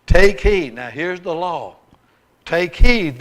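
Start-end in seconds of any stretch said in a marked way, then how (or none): 0.1-0.7 booming command. Acted. Preacher stressing scripture command. 2.4-3.2 booming command. Acted. Preacher repeats scripture command.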